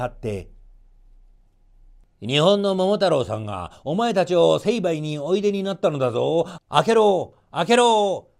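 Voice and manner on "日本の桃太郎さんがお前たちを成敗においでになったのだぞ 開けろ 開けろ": loud, commanding bark, louder and higher on 開けろ; shouted demand. Acted. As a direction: pound on the gate and yell to open up. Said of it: "と怒鳴りながら". (門を叩きながら怒鳴る犬)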